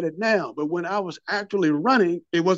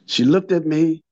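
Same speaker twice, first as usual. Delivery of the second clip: normal talking voice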